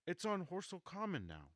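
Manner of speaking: high, loud, urgent news; excited. Acted. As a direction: blurt it out excitedly. Ogilvy breathlessly breaking the news.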